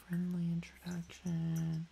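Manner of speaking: soft unvoiced whisper of the words. Real, not acted. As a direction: breathe them out as a secret. Reading the game option again.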